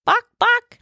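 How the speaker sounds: high playful chicken clucking; chicken clucks. Pretend. Mom clucks like a chicken at the question, high playful cluck.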